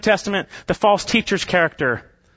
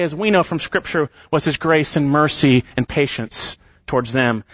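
ordinary talk

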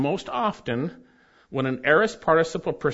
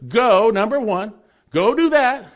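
emphatic go; 5 semitones higher, 7 dB louder than usual. Retold or imitated. Mocking church's emphasis on go.

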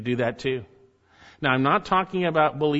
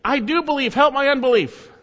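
much higher, louder pleading cry; 13 semitones higher, 8 dB louder than usual. Acted. Father crying out to Jesus, loud and pleading. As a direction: cry out the plea at full voice. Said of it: "the boy's father cried out".

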